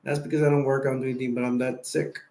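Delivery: ordinary delivery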